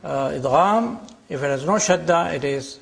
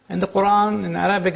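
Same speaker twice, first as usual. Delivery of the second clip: ordinary talk, nothing marked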